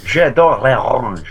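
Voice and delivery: put-on slow, hammy French accent; mock French accent. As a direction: drawl it like a Frenchman.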